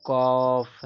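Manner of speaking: कॉफ loud, with the open "aw" stretched long; drawn-out model. Shown as a demonstration. Teacher drilling students on cough, कॉफ drawn out. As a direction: hold the vowel of कॉफ, then close on f. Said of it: "इसको कॉफ पढ़ते हैं".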